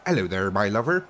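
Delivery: hammed-up West Country farmer accent; mock West Country yokel accent. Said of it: "the West Country as they call it over here, accent".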